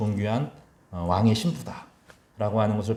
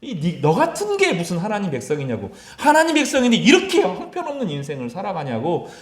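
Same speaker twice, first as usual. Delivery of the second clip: much higher, louder jeering with a laugh; mocking taunt; 11 semitones higher, 5 dB louder than usual. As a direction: sneer at the believer as a bully would. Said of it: "막 조롱하는 거예요". (비웃으며 높고 크게 조롱하듯)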